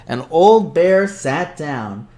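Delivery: ordinary delivery, nothing marked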